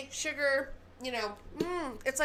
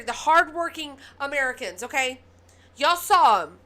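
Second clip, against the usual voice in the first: rising angry shout, louder and louder; outraged rant; 2 semitones higher, 8 dB louder than usual. Real, not acted. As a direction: let the fury build up to a shout. Outraged rant about Ohio aid.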